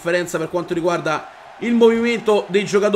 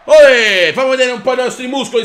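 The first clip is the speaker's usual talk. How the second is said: loud cheering "Olè"; triumphant cheer; 4 semitones higher, 8 dB louder than usual. Real, not acted. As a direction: shout it out in triumph. Celebrating the move.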